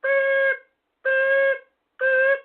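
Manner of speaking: high, thin imitated backing-up beep; mock reversing beep. Mimicking a scooter backing up, high mock beep. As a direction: squeak out a mobility scooter's reverse alarm. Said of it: "they do that beep".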